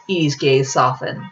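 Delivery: usual speaking voice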